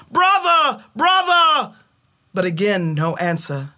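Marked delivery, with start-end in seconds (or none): 0.1-1.7 loud, high echoing call into the caves. A narrator's reading. Older brother calling into dark caves, loud high call. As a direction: call into the dark hoping for an answer.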